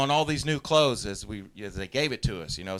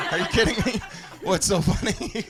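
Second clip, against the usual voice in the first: incredulous laughing; 6 semitones higher than usual. Acted. Comic retort to angry superior.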